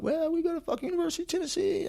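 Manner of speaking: high, fast, hammed-up college-kid voice; mock college kid. Mocking the Tennessee students with a high, quick, put-on voice. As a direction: squeak it out like a goofy student.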